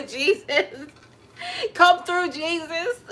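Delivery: high, loud, laughing cry to Jesus; mock shower wailing. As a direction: ham up a tearful plea in the shower. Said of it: "I be in the shower like".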